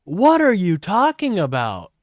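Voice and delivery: ordinary talk, nothing marked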